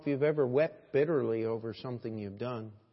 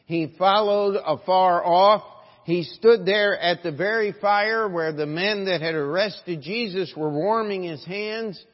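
loud, raised preacher's delivery; full-force preaching; 6 semitones higher, 10 dB louder than usual. Real, not acted. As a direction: drive the story home with force.

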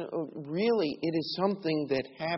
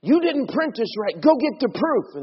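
high, loud angry order; angry boss; 10 semitones higher, 10 dB louder than usual. Retold or imitated. Boss yelling at Dave over the misprinted proof, angry. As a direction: bark it like a furious boss. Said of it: "he was mad about something".

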